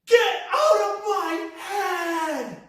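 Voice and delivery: high, slow, drawn-out scream; stretched-out deranged shout; crazed yell. Retold or imitated. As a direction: yell it like a madman on a bus. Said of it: "and he goes".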